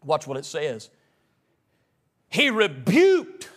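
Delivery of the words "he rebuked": REBUKED drawn out slowly and punched; slow, forceful weight on "rebuked"; emphatic rebuke. Shown as a demonstration. Preacher reading how Jesus met the wind, emphatic rebuke. Acting out verse's rebuke against saying.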